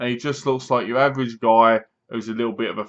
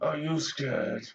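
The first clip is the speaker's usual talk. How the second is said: slow, drawn-out menacing taunt, fading to a hush; slow taunting killer, dropping almost to a whisper; mock creepy killer voice; 4 semitones higher, much slower than usual. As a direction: play the masked killer teasing his victim. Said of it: "with the gun like this".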